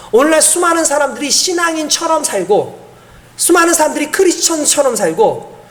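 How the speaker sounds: normal talking voice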